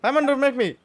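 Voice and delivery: ordinary delivery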